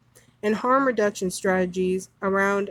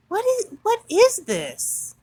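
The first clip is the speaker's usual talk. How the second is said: outraged disbelief; 10 semitones higher than usual. Real, not acted. Outraged at third grade romance.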